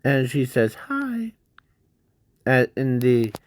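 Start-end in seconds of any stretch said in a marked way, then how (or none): 0.9-1.3 put-on very high, soft little girl voice; Zilly's girly voice. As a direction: squeak it out like a shy little girl. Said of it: "in the high-pitched voice".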